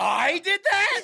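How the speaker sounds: high, slow, loud disbelief; shouted question. Pretend. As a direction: yell it as a baffled question.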